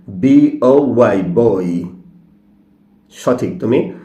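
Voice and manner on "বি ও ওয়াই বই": জে ও ওয়াই spelled slowly, then জয় said clearly; slow spelling, then the word জয়; correct pronunciation. Shown as a demonstration. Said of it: "সঠিক উচ্চারণ".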